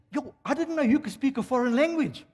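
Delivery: high-pitched astonishment; acted surprise at a discovery; imitated amazed workmate. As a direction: exclaim it high, as a surprised mate. Acts amazed workmate in anecdote.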